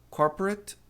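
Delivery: normal talking voice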